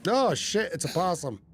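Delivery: sudden high startled curse; jolted by a possum in the drawer. Real, not acted. Streamer finds a possum in drawer, startled.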